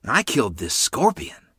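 put-on a touch of boyish boasting; proud. A narrator's reading. Boy shows his sister a scorpion, mildly proud. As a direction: proudly show off the catch. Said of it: "I said proudly".

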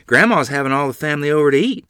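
put-on a kid jumping in to get their way; eager piping in. A narrator's reading. Child eagerly chiming into parents' talk. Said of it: "I piped in".

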